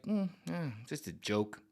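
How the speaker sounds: offhand mumble, nothing to see here; shrugging it off. Retold or imitated. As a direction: play it down softly and shrug. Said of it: "Be like".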